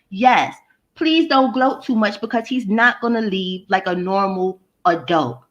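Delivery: normal talking voice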